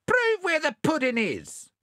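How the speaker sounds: high, slippery possum voice; sly possum voice. Acted. Possum argues.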